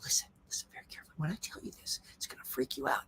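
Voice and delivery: fast, hushed whisper; mock whisper acting. Shown as a demonstration. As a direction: rush it in a dramatic whisper. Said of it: "They will not".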